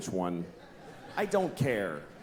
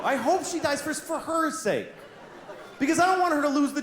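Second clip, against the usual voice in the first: open declaration; 9 semitones higher than usual. Real, not acted. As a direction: own the thought proudly to the crowd.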